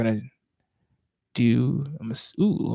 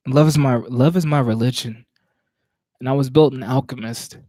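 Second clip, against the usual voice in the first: slightly higher, measured poetry recital; spoken word poem; 3 semitones higher, 3 dB louder than usual. Acted.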